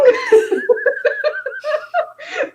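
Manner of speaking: a high, delighted burst of laughter. Real, not acted. After joking about reading comics backwards, high laugh. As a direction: laugh freely at the joke.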